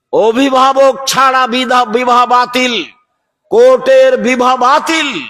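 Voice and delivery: high, drawn-out preacher's cry; emphatic proclamation. Real, not acted. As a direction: proclaim the ruling at full voice, slowly. Preacher thunders out a religious ruling. (উঁচু গলায় টেনে টেনে ঘোষণা)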